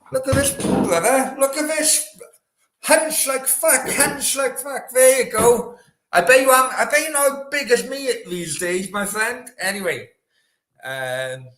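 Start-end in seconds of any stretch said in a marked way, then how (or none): 0.1-9.5 high-pitched, excited boast that gets louder; boastful showing off. Real, not acted. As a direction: show off to a friend, voice pitched way up.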